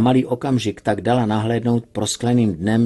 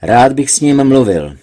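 cold and tense, a touch louder; tense, chilly; 3 semitones higher, 8 dB louder than usual. Acted. Boss over the headset, icy and tense. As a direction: let the menace sit under polite words. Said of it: "Lienův napjatý hlas".

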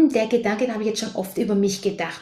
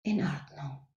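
meditation whisper; 3 semitones lower, 7 dB quieter than usual. Acted. Guide leading the group out of meditation, whispering softly. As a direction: whisper gently as the meditation closes.

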